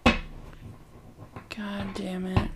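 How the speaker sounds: slow frustrated whisper; whispered curse. Real, not acted. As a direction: hiss it under breath. Whispered frustration, can't recall.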